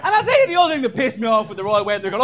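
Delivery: ordinary delivery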